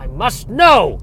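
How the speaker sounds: high, loud, drawn-out cry; melodramatic demand. Acted. Comedian acting out a desperate need to know, melodramatic demand. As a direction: demand it with mock-epic urgency.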